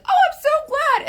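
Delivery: very high, loud mock gushing; gushing relief. Imagined viewer grateful for the advice, gushing.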